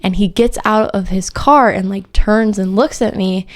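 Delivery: ordinary delivery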